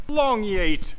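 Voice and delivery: high, loud yell stretching on "Long"; long yeet shout. Real, not acted. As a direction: celebrate a long throw. Long throw of junk.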